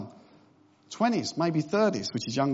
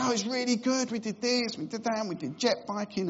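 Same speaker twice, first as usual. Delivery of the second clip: higher, eager mock-excited rundown; excited honeymooner; 6 semitones higher than usual. Imitating a returning newlywed excitedly listing what they did. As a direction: gush about the trip like a thrilled newlywed. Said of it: "they always come back excited".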